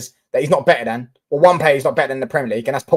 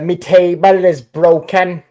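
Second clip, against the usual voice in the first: slow, drawn-out Welsh accent, a bit louder; 3 semitones higher, 5 dB louder, much slower than usual. Shown as a demonstration. Streamer obliging a viewer's request, in a put-on Welsh accent. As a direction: put on your best Welsh accent, stretching the words. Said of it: "can you do your best Welsh accent".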